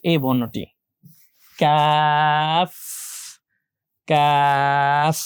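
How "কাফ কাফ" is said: very long "কাফ" with a clear final f; letter-name demo. Shown as a demonstration. Teacher models the f ending, drawn-out pronunciation. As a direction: hold the a in "কাফ", then release into f. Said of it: "এফ শেষে ফ".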